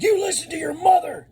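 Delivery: high, loud yell; scolding a child in a grocery store; angry shout. Retold or imitated. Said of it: "Oh, I got so mad".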